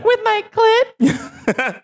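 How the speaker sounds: very high, drawn-out squeal; shocked squeal. Acted. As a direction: squeal it in mock shock, stretched out. Acts out a skit, shocked question.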